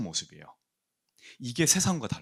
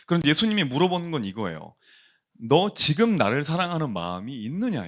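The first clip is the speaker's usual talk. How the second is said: louder, higher fervent sermon; preaching; 4 semitones higher, 5 dB louder than usual. Acted. Pastor confronting the congregation's shame, fervent preaching. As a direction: build to the question with conviction.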